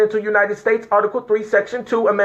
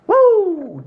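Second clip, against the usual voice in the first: high, falling "woo"; excited whoop; 10 semitones higher than usual. Real, not acted. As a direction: whoop high and let it slide down. Elated outburst after urging unity.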